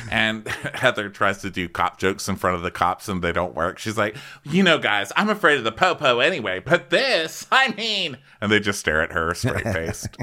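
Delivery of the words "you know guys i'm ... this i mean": high, quick, climbing chatter; hammed-up high quoting voice; nervous joking imitation. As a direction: babble a bad joke, rising higher as it goes. Acting Heather's failed cop jokes.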